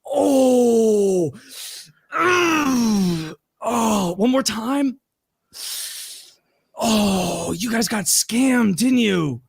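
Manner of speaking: high, drawn-out theatrical moaning; mocking wail. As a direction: imitate a host gloating in exaggerated agony. Mock wailing at scammed contestants, to camera.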